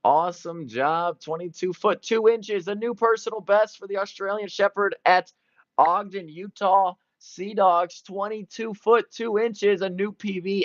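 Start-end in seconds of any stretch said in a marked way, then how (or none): 1.2-10.6 pitched way up, thrilled by the record jump; excited announcing. Real, not acted. Commentator hails Ludo's new personal best, excitedly higher. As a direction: call the new best with rising delight.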